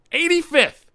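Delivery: high, indignant exclamation; outraged disbelief. Acted. Comedian reporting his race placing, outraged disbelief.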